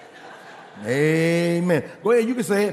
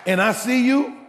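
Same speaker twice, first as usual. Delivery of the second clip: raised, higher voice hammering for the money; angry demand; 8 semitones higher, 4 dB louder than usual. Retold or imitated. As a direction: demand it back like a wronged lender, loud and high. Retold confronting debtor demanding money.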